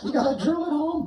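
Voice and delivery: high squeaky dummy voice; ventriloquist dummy voice. Acted. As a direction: pitch it up like a puppet.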